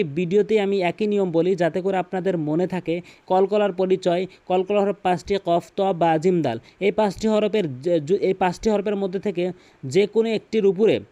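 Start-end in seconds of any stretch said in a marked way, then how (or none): none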